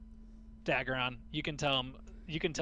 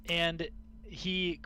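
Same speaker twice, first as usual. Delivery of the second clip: ordinary talk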